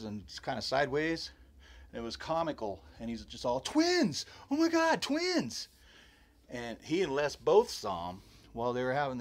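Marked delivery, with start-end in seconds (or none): 3.6-5.7 very high, loud mock panic cry; imitated freak-out. Michael running from the rock fight, imitated freak-out. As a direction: act out a friend shrieking the alarm. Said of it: "they were both kinda freaking out".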